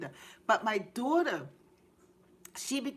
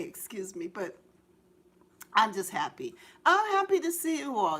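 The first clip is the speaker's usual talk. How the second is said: ordinary talk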